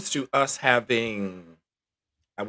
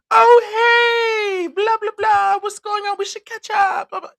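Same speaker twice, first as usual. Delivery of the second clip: shrill, much higher and louder mock squeal; mock eager greeting; 8 dB louder than usual. Mocking old hookups who resurface, squealing much higher and louder. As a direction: parody a fake cheerful hello.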